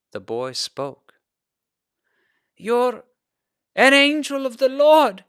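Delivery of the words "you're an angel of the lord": much higher, louder, squeaky and raspy; a weak boy squeaking out his first words. Acted. Dazed boy tells Eliakim he is an angel, squeaky and raspy. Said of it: "his voice squeaky and raspy".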